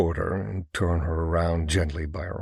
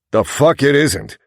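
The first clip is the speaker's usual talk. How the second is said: loud, raised snapping retort; angry retort; 8 semitones higher, 11 dB louder than usual. Acted. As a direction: bite back hard. Heated argument.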